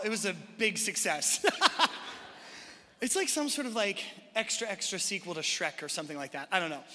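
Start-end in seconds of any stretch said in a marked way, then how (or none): 1.5-2.7 airy laugh. Real, not acted. Laughs at the single hand.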